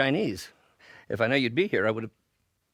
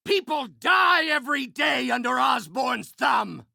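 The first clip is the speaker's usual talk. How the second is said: high, impassioned accusation; outraged shout; 7 semitones higher than usual. Acted. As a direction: cry out in righteous anger. Villain accuses his enemy.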